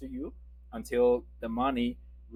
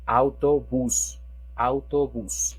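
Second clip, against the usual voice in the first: "autobús" slow and loud, last syllable stressed; stress on BÚS; 6 dB louder, slower than usual. Shown as a demonstration. Teacher demonstrates phonetical emphasis, stressing final bús. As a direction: punch the end of "autobús". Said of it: "we have to make the emphasize".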